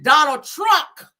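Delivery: loud, high, drawn-out name; Shamar. Acted. Quoting who is behind this, loud high slow impression. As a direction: proclaim the name slowly in a hammed-up impression. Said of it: "Shamar said".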